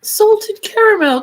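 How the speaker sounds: put-on high, loud, slow sing-song British accent. Chatting on stream, hamming up a British accent. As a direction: stretch the words out in a big British accent.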